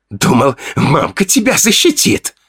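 hoarse taunt. Acted. Fedya, breathing hard in the narrator's face, rasps a taunt. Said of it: "прохрипел он".

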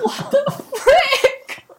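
high, loud, drawn-out disbelief with a laugh; laughing, high-pitched incredulous yelp; shocked outburst. Retold or imitated. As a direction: squeal it in mock outrage, stretching the words. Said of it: "And I was like".

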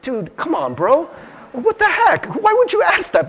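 high, incredulous "what the heck"; exasperated retort. Retold or imitated. Boy answering kids who mock him, exasperated.

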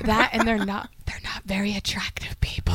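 soft, secretive aside; confiding whisper. Pretend. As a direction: drop to a whisper so they won't overhear. Gossiping about neighbors within earshot.

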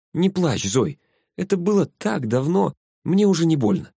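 put-on high-pitched, childlike reassurance; lightly acted consoling; comforting girl. A narrator's reading. Dead girl comforts crying Зоя.

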